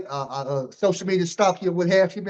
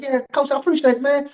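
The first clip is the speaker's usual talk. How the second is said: much higher, quick and eager greeting; high fast coach voice; 8 semitones higher, 3 dB louder than usual. Retold or imitated. Host imitating the coach walking up to greet him, high and fast. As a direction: chirp a hello like a wound-up coach. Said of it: "He's like".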